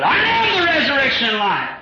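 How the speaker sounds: high ringing shout of Christ's words; shouted proclamation. Acted. Preacher crying Jesus' words to the church, high shout. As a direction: cry it out to the congregation.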